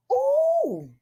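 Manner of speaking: high squeal of alarm; surprised ooh. Real, not acted. Host hitting audio trouble, a high surprised ooh. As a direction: yelp it way up high. Sound problems on stream.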